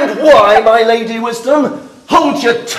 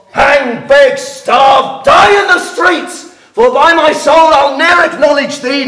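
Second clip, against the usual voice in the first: angry shout; 5 semitones higher than usual. Acted. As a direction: hurl each threat. Father threatens daughter.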